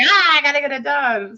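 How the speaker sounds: high-pitched playful panicked cry; mock panic. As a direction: act out a last-minute deadline freak-out. Acts out her last-minute panic.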